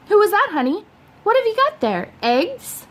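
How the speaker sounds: a lightly sung-out mother asking; mother's voice. A narrator's reading. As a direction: voice the curious mom, lifted up high.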